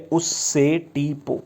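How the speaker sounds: each syllable stretched out; slow mnemonic. Shown as a demonstration. Teacher reminding students of the Group Sixteen trick, slow and drawn out. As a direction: say it slowly so it sticks. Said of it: "खाली याद कर लें".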